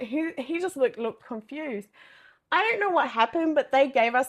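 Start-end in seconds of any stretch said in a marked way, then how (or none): none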